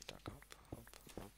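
soft unvoiced hops under the breath; quiet rhythmic whisper while climbing; whispered counting. Real, not acted. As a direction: whisper each hop to yourself.

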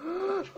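sudden frightened gasp; sharp gasp. Real, not acted. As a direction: gasp in shock. Sheep attack scare.

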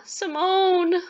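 high, drawn-out call for a lost cat. Acted. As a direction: cry the name out into the distance. Sylvia calls out to lost cat.

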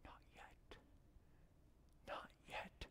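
barely audible, spaced whisper; silently mouthed words. Acted. Master seen through the oven door, whispering refusal. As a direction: mouth it slowly with almost no voice. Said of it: "I could read his lips".

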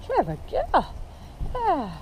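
squeaky praising a dog. Real, not acted.

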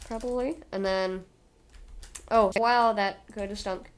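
ordinary talk

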